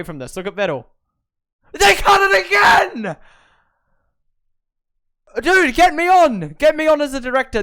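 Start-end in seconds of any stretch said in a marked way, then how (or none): 1.7-7.6 high, loud exasperated yell; frustrated shout. Real, not acted. Fan furious the broadcast cut away, frustrated shout.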